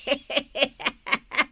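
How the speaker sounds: short teasing laugh at her; mocking laugh. Neighbour laughs at the quarrel. (naggalgalak a manguy-uyaw)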